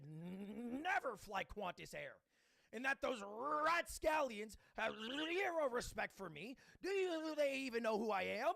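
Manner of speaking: hammed-up outraged influencer, rolling the sounds; indignant manifesto. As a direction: declaim it from a stupid high horse. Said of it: "preparing a manifesto in my head".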